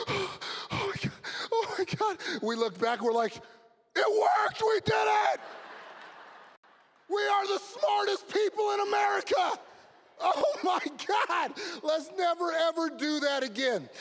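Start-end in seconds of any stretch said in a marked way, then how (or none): 0.1-2.2 high, gasped mock shock; shocked gasping. Kids stunned after crash. 3.3-9.6 high, loud, hammed-up celebration yell; triumphant kids. Kids celebrate after crash. 10.2-13.9 high mock cry easing downward; hammed-up shock settling into resolve; shaken kid. Kid relieved, shaken after ride.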